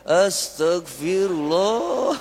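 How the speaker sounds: astagfirullah stretched very slow with a rising, irritated tune; mock-annoyed astagfirullah, long and rising at the end; exasperated astagfirullah. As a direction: drag out astagfirullah like a rider who just got cut off. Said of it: "berubah intonasinya".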